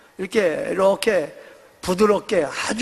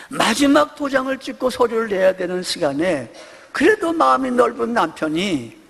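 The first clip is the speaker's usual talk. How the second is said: ordinary talk, nothing marked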